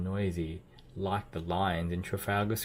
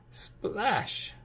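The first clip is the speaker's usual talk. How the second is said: splash; 13 semitones higher than usual. Acted. Falling into water.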